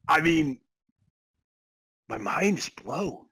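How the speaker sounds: ordinary talk, nothing marked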